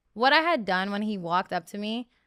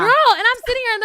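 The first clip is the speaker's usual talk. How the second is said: outraged gush; 13 semitones higher, 11 dB louder, faster than usual. Real, not acted. Outraged retelling.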